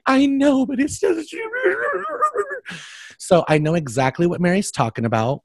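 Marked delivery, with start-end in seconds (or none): 0.1-1.4 high, hammed-up mock cry; mock whine. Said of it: "And I'm like". 1.4-2.8 mock wail into laugh. Mock cry breaks into laugh.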